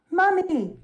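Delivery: put-on a high child's cry for her mother; little girl crying out. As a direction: call out for mommy like a small child. Child Robin calling her mother.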